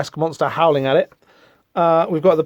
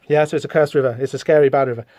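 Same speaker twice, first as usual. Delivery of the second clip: ordinary talk, nothing marked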